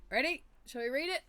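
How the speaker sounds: bright high call of ready; eager high ready; excited. Real, not acted. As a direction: perk up and ask loudly. Excited prompt.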